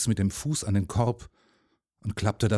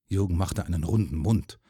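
plain reading voice

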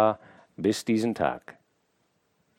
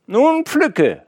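high, louder playful call; 5 dB louder than usual. A narrator's reading. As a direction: call down to her, teasing. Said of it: "rief er".

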